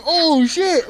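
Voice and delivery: high, laughing "oh shit"; laughing disbelief. Shown as a demonstration. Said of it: "laugh that you do when someone says something".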